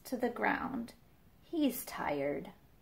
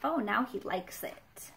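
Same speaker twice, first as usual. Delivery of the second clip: usual speaking voice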